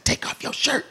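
high, unvoiced whisper. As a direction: breathe it out high and soft. Manager answers on the loud stage.

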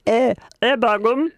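a hint of northern English dialect; northern English accent. Shown as a demonstration. Co-host showing off a Liverpudlian accent, lightly northern English. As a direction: toss off a northern English exclamation.

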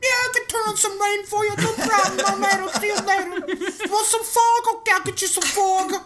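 high, eager cartoon cloud voice; a cheery high-pitched sky spirit; Mister Cloud voice. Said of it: "it's a great Mister Cloud impression".

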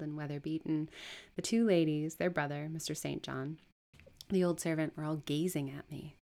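ordinary delivery

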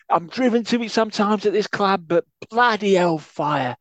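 high, loud put-on manager voice; Betis impression. Co-host doing Betis ranting about the club shop. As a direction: rant in character, ranting and fuming. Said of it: "That went a bit steptoe at the end".

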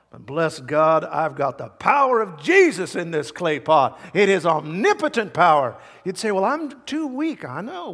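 loud, high-pitched bursts of pulpit fervor; fervent preaching. Real, not acted. Preacher urging congregation about clay pots, fervent preaching. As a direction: rouse them, punching the key words.

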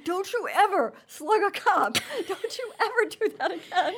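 a hint of a stern scolding, laughing; tender scolding. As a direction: scold fondly through laughter. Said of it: "Ray behind me going".

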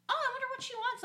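high-pitched, lilting wondering aloud; retold curious musing. Friend seeing an incoming FaceTime, high curious musing. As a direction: muse in a very high voice.

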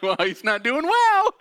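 high, loud and slow, laughing; laughing aside. Retold or imitated. To himself about the crying man, laughing high and loud. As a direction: chuckle it out, half shocked. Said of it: "I'm like".

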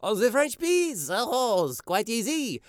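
put-on French accent. Asked about French peas.

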